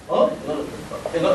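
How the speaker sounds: usual speaking voice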